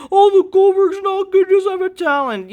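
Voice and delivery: very high, loud mocking whine; mocking whiny fan. Vlogger mocks fans who knock Goldberg, high whiny voice. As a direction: parody the critics in a silly squeal.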